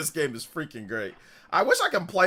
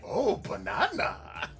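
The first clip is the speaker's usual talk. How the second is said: slow, drawn-out goofy imitation; mock banana voice; 5 semitones higher, 5 dB quieter, much slower than usual. Streamer to chat, slow goofy banana impression. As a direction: stretch it like the game character. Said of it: "you say".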